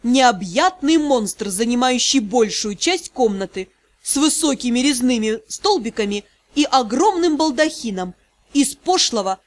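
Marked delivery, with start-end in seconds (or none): none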